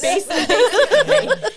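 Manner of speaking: high and loud, laughing. Real, not acted. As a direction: laugh while agreeing. Laughing agreement at a joke.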